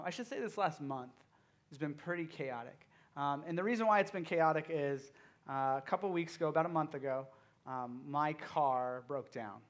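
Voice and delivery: ordinary talk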